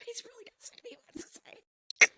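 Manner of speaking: breathy teasing stage whisper. Acted. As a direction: whisper it like a secret aside. Teasing whisper about Dave.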